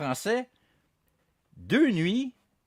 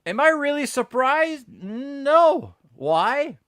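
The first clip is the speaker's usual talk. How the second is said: sarcastic rhetorical; 12 semitones higher, 8 dB louder, slower than usual. Real, not acted. Mock rhetorical disbelief.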